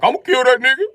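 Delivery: high loud threatening yell; loud high threat; friend yelling. Retold or imitated. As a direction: voice the friend raging. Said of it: "My guy like".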